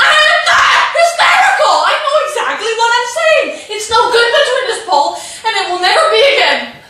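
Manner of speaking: high, shaky yelling with laughing sobs; hysterical. Acted. Wife denying hysteria to her husband, shrill tearful yelling. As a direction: insist you're calm while coming apart. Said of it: "[name], you're hysterical".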